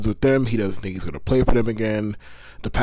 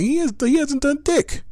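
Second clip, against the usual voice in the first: exasperated emphasis. Real, not acted. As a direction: pitch jumps way up, incredulous. Repeats complaint, pitch leaps up.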